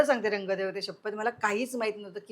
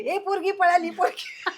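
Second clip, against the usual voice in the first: mock crowd shout, raised high; people shouting; 7 semitones higher than usual. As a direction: call out like a startled crowd. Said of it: "सगळे लोक ओरडायला लागली". (लोकांचा ओरडा)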